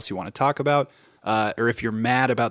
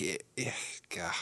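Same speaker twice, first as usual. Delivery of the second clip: exasperated gah; 5 semitones lower, 15 dB quieter than usual. Retold or imitated. Mock exasperated noise.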